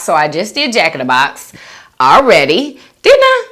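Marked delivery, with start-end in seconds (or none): 3.0-3.5 much higher, louder question; surprised. Real, not acted. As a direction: squeak it up in surprise.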